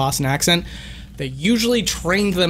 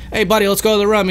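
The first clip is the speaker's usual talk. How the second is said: lightly put-on Boston accent, a bit higher; 4 semitones higher, 3 dB louder, much faster than usual. Streamer showing where his "room" comes from, light Boston accent. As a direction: do a casual Boston buddy, short "room". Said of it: "like, a Boston accent where it's like".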